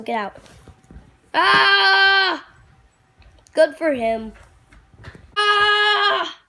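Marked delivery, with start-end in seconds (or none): 1.3-2.5 loud high falling "ah"; loud scream while falling. Acted. Thrown down to earth. 5.4-6.4 loud high falling "ah"; loud scream while falling. Acted. Thrown down to earth.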